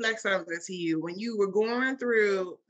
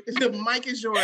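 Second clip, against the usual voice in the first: ordinary delivery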